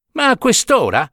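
irritated priest huffing at the hour; huffing complaint. A narrator's reading. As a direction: puff it out, put upon. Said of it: "ripeté don Marchino sbuffando".

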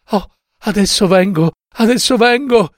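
high-pitched, flustered wife; placating wife. A narrator's reading. Wife answers her threatening husband, placating. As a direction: call back hurriedly that you obey, high. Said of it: "rispondeva la moglie".

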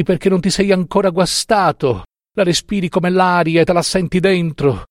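put-on high, wailing drunken outburst; anguished imploring. A narrator's reading. Drunken professor wanting his immortal soul out, anguished wail. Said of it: "implorava il professor Carmelo Sabato, tra le lagrime".

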